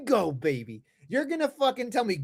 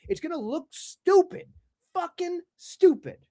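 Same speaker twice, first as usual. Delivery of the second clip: higher, louder, slowing on the last words; angry outburst; 6 semitones higher, 4 dB louder than usual. Real, not acted. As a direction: spit out the final verdict. End of angry rant.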